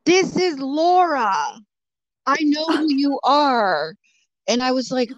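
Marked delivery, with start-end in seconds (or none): 0.0-3.9 slow, higher, ominous mock voice; menacing message read aloud. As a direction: read the message out slowly like a creepy threat. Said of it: "Then I got Margaret in my D M".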